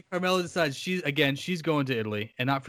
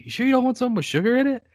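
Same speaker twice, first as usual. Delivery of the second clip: high, quick, put-on questioning voice; mock solicitous gangster; 10 semitones higher, much faster than usual. Tony checking on the doomed man's drink, high mock concern. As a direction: ask quickly with exaggerated concern. Acting Tony's sly question.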